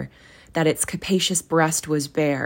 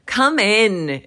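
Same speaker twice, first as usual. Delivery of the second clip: booming ghost welcome, higher and louder; exclaimed; 6 semitones higher, 5 dB louder than usual. A narrator's reading. Ghost hailing Scrooge from his throne, loud and hearty. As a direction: call him in like a jolly giant. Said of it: "exclaimed the ghost".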